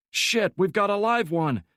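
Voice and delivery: high, loud urgent shout; alarmed shout. A narrator's reading. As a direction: yell the warning to the team. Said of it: "Cartwright said, raising his weapon".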